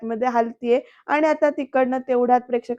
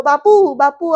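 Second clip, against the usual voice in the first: loud shout; 3 semitones higher, 8 dB louder, slower than usual. Retold or imitated. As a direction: yell out to stop him, alarmed. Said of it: "एकदम ना जोरात ओरडतो". (जोरात ओरडून हाक)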